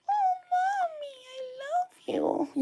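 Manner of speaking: very high, slow, squeaky crying pet voice; voicing the dog. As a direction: speak for the dog as a tiny whimpering baby. Voicing the dog's reply.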